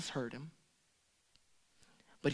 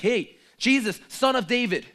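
loud high call; 8 semitones higher, 10 dB louder than usual. Retold or imitated. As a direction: shout for Jesus across the crowd. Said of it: "didn't care what attention".

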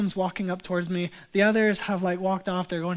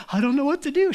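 mock cheerful confusion, higher; bingung tapi senang; 7 semitones higher, 5 dB louder, faster than usual. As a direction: driver sounding lost but pleased. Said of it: "the person driving is just like".

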